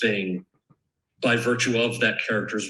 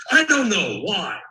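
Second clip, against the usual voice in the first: high, loud startled "I don't know"; a touch of wary surprise; startled reply; 10 semitones higher, 5 dB louder, slower than usual. Retold or imitated. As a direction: caught off guard mid-rant. Quoting himself to manager after rant.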